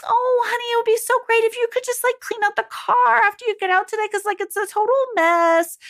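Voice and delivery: high, rushed, wheedling mom voice; pleading mom. Acted. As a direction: ask in a high-pitched, over-sweet hurry. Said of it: "Don't be like".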